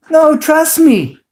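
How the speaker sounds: high, slow, sure of herself; confident wife. Retold or imitated. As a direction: imitate the wife calmly insisting. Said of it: "she was so confident".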